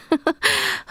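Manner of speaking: bright little embarrassed laugh; light laugh. Acted. Princess amused at calling him Ray, light laugh. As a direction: giggle at yourself. Laugh after correcting name.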